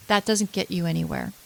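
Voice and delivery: normal talking voice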